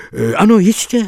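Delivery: exclaimed. A narrator's reading. Both listeners call out eagerly to the actuary. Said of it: "zvolali jsme oba".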